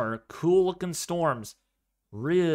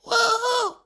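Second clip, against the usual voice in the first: very high squealed woohoo; falsetto girly woohoo; 6 dB louder than usual. Pretend. Streamer squealing woohoo in falsetto for chat. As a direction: jump into a girlish falsetto. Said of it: "convincing me to say".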